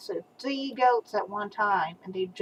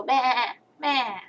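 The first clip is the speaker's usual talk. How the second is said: put-on a mild bleating baa for a toddler; sheep bleat; much slower than usual. Shown as a demonstration. Grandma showing the child how a sheep sounds, lightly bleated. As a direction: bleat it like a sheep for the little one. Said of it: "You go".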